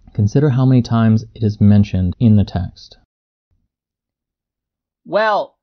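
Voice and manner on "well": high, slow admonishment; stretched-out character voice, pitched up; admonishing character. A narrator's reading. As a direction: caution him deliberately, pitched well up. Man in story admonishes narrator.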